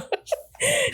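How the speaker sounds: high squealing laugh; a squeaky fit of giggles. Real, not acted. Laughing at own story.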